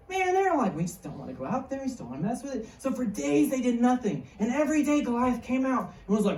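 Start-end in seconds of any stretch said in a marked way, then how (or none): 0.7-2.7 soft, timid reluctance; mock scared. Israelites backing away from the giant, mildly hammed-up timid murmur. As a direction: shrink back quietly, acting scared. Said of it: "like, really scared of him".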